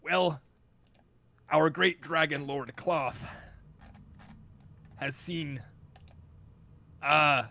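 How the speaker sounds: loud, slow, halting dragonborn envoy. Acted. Envoy explains the dragon lord's stake from notes, loud and halting. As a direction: declaim it loudly, stalling to check the notes. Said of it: "he says".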